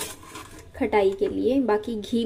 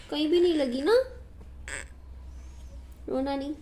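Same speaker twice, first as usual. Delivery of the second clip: higher, coaxing reassurance; 4 semitones higher than usual. Real, not acted. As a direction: reassure the little one mummy is here. Mother consoling hurt son. (चोट लगे बच्चे को मनाती माँ, प्यार से)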